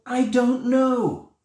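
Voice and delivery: high, loud, drawn-out "I don't know"; comic cluelessness. Acted. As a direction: throw your hands up vocally, slow and high-pitched. Answers own question in mock despair.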